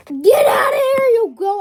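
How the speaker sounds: loud high yelling; playful loud shout. Acted. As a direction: yell it high at them. Shouting at someone in trend.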